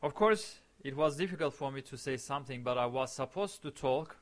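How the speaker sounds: ordinary delivery, nothing marked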